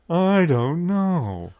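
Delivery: slow swooping mock-host voice; Lisa's voice. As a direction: stretch it out in an affected character voice. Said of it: "she talked just like that".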